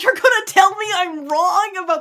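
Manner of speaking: high, slow disbelieving quote; incredulous. Retold or imitated. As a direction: act offended in disbelief.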